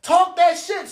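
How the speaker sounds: high, slow hype shout; hyped crowd. Retold or imitated. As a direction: egg him on like a roaring crowd. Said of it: "The whole crowd go".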